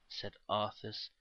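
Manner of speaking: plain reading voice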